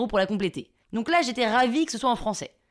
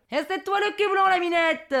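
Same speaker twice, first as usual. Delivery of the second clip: heckling southern local; 8 semitones higher than usual. Retold or imitated. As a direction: throw the insult back teasingly. Local heckles her back. (Marseillais qui réplique en criant)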